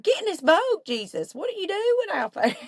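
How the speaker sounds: high, incredulous call to Jesus; mock alarmed plea. Imagining herself in the boat calling to Jesus, high incredulous plea. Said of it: "and I'd be like".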